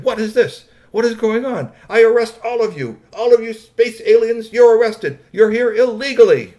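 high, loud arresting shout; outraged official. A narrator's reading. Heinrich Himmelstein arrests the space aliens, outraged shouting. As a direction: shout them down with full authority.

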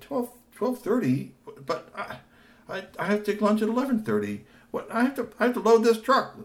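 high anxious protest; high flustered Tony. Acted. Tony worrying about his lunch hour, flustered. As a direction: fret about the schedule.

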